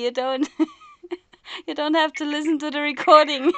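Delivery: laughing through the words; giggling while teasing. Real, not acted. Laughing at own loud voice.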